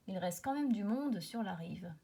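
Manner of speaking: plain reading voice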